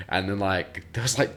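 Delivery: ordinary talk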